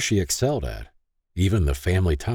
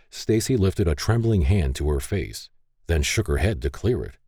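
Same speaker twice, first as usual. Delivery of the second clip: ordinary delivery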